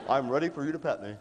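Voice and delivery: a lightly voiced dog, low and laughing; dog's voice. Voicing a pet dog begging to be scratched, lightly and laughing. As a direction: give the dog a lazy, chuckling voice. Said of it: "look at you like".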